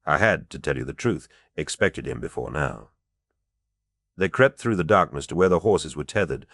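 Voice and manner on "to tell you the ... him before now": ordinary delivery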